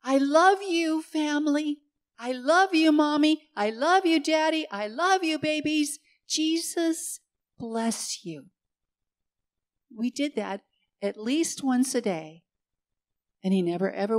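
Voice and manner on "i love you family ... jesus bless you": high and loud, calling up to hospital windows; child's happy calling out. Retold or imitated. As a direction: call out like an excited little boy waving at the windows. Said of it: "And he'd call out".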